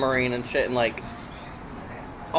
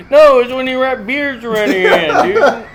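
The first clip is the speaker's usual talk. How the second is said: higher, laughing loudly; 8 semitones higher, 5 dB louder than usual. Real, not acted. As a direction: laugh through the words. Laughing explanation.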